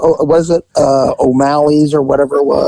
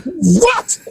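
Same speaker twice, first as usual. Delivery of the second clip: startled; 11 semitones higher than usual. Retold or imitated. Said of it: "I'm like".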